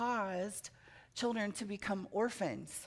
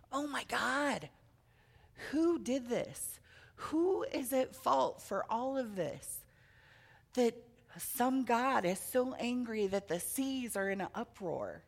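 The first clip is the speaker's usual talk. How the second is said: higher, slower voice of frightened sailors; storyteller voicing sailors who panic in the storm; panicked sailors; 4 semitones higher than usual.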